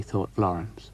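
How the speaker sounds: ordinary delivery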